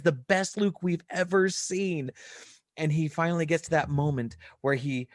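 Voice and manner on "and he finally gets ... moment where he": ordinary talk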